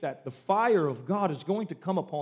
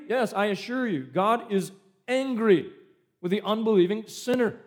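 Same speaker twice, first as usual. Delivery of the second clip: normal talking voice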